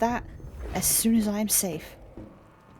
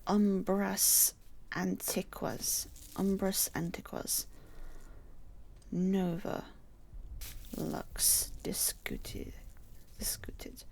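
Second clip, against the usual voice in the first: incantation; 5 dB quieter, slower than usual. Real, not acted. Reading Latin incantation slowly.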